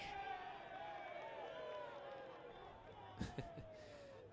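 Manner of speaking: long breathy silent laugh; wheezy laugh. Real, not acted. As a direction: laugh without voice at the replay. Laughs at the foul replay.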